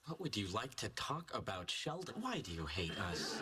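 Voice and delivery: loud, exaggerated mimicry; mocking shout. Friend mocking Penny's question to Sheldon, loud mimicry. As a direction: throw her words back at full volume.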